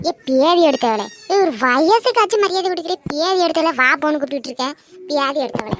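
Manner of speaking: loud, indignant telling-off; angry scolding. Acted. Old woman loudly scolds the neighbour for calling her disrespectfully. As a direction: bark at her for the lack of respect.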